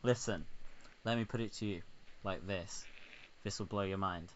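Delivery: quiet, halting pitch with weighty pauses; mock-profound president. President persuading the French PM, quiet and portentous. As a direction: lay it out softly, pausing between phrases. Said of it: "he's like".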